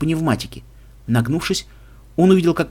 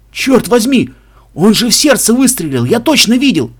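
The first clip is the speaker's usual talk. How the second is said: high, loud disbelieving outburst; shocked exclamation; 8 semitones higher, 7 dB louder than usual. A narrator's reading. Bystander amazed the shot girl is alive, loud and shocked. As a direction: cry out in startled disbelief.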